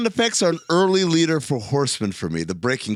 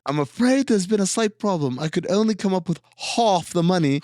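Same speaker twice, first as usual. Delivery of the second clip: higher, put-on British accent; 5 semitones higher than usual. British dad confessing he is short, mock British accent. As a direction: play the apologetic Brit with an accent. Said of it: "this Brit and this southern woman".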